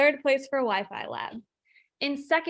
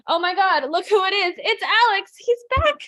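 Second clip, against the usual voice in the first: excited; 7 semitones higher, 6 dB louder than usual. Real, not acted. Co-host returns, delighted.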